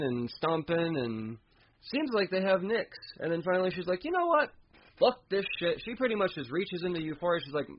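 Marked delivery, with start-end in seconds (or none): 4.0-5.8 high, exasperated mock villain; villain losing patience, exasperated; fed-up villain. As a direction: pitch it way up, done with this. Nyx gives up and grabs.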